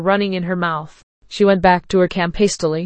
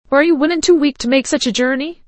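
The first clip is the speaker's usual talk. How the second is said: higher-pitched taunting question; 7 semitones higher than usual. A narrator's reading. Stranger teasing horseless priestess as he leaves, taunting. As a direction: tease her with a lifted, incredulous question. Mocking question, then he laughs.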